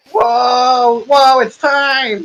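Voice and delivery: high, drawn-out crowd-rousing call; hype-man announcer. Acted. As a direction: whip up the crowd in a high, stretched voice. GM voices milkman hyping crowd.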